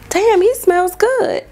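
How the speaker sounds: high, slow, swooning "damn, he smells good"; dreamy gasp of admiration; swooning compliment. Retold or imitated. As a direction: drawl it out high like you're swooning. Gushing over server's cologne.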